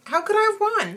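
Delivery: high-pitched mock disbelief; can't believe it, voice jumping up; incredulous. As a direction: imitate her stunned disbelief. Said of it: "And she's incredulous".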